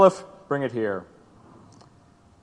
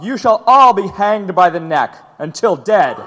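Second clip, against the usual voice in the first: stern sentence; 4 semitones higher, 6 dB louder than usual. Acted. Judge pronounces death sentence.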